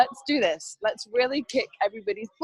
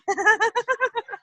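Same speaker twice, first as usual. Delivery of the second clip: high, loud burst of laughter; real high-pitched laugh; 14 semitones higher, 7 dB louder than usual. Real, not acted. Amused by friends taking her picture.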